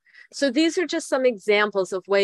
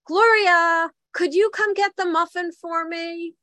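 calling dad; 9 semitones higher than usual. Retold or imitated. Dad calling wife across the house, high mock call. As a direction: mimic dad calling his wife from far off.

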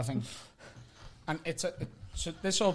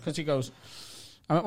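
ordinary delivery

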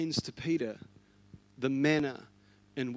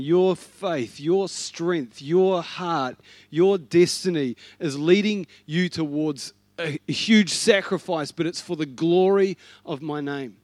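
strong declaration; 2 semitones higher, 8 dB louder than usual. Acted. Jesus voiced calling out destiny.

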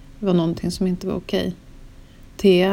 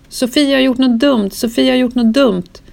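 higher, louder, urgent mock cry; a mild retold alarm, not full force; panicked cry; 6 semitones higher, 5 dB louder than usual. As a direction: barefoot friend bursting in, crying out in alarm.

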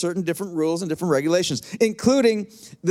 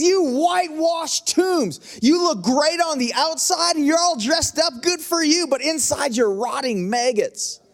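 raised, slow shouted denunciation; fierce rebuke; 6 semitones higher than usual. Retold or imitated. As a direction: blast the hypocrites without holding back. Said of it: "He didn't hold back".

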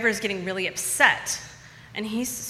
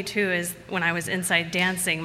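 ordinary talk, nothing marked

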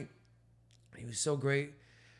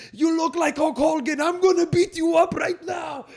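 high, loud wrestler threat; Iron Sheik yelling; 14 semitones higher, 10 dB louder than usual. Retold or imitated. Iron Sheik on stage screaming at a grown fan, high loud threat. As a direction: shout a hammy threat at a fan. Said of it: "yelling in their face".

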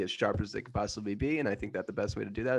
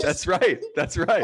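gloating; 10 semitones higher, 8 dB louder, slower than usual. Acted. Villain gloating.

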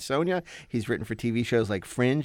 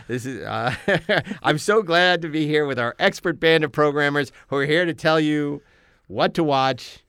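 usual speaking voice

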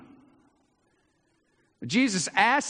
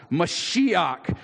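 usual speaking voice

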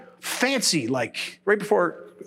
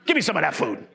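low, softer begging grab for food; greedy hungry begging; 7 semitones lower, 3 dB quieter, faster than usual. Retold or imitated. A hungry man grabbing for free food, low greedy begging. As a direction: mumble it like someone starving who'll take anything.

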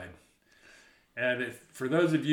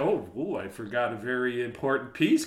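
plain reading voice

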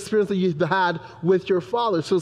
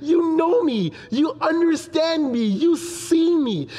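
put-on higher, delighted little-girl voice; happy toddler voice; 7 semitones higher, slower than usual. Father voicing his delighted daughter, higher and joyful. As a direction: voice a toddler overjoyed at being understood. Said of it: "She was like".

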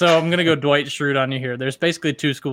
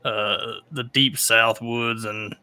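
deep Southern accent; 2 semitones lower than usual. Shown as a demonstration. Host playing up his deep South woods roots, low Southern accent. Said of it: "as your voice gets deeper, it, like, naturally accents".

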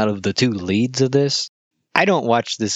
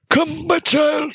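put-on high, slow, breathless rasp of an old rebel leader; wheezy voice; slower than usual. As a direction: wheeze it out like you can't catch your breath. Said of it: "he talks like this".

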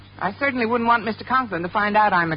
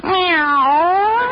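high, loud, rising meow; cat's meow; 10 semitones higher, 5 dB louder than usual. Acted. Cat Minerva in the biology lab, high rising meow. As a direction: meow like a cat wanting attention.

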